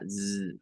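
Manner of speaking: "Z" as a buzzing, vibrating s sound; just the bare voiced sound "Z". Shown as a demonstration. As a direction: hum through the s to make "Z". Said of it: "S vibrante".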